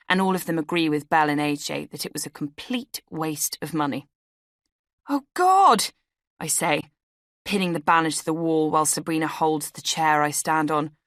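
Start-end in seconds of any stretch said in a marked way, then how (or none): 5.1-5.9 high, loud "oh God" of panic; sudden alarm, voice shooting up; high startled cry; dismayed gasp. A narrator's reading. Narrator voices her own panic.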